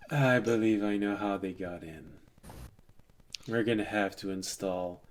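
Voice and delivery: low, soft, weary voice; warlock. Acted. Warlock explaining how they got in, low resigned voice. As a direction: sigh through it quietly and low. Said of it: "you hear a warlock say".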